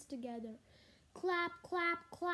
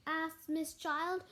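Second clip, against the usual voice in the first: plain reading voice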